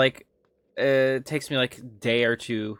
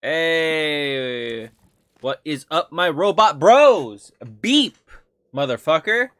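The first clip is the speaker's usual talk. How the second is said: robot bro shout; 3 semitones higher, 8 dB louder, much slower than usual. Pretend. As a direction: hype up the robot crowd. Hypes robot bros.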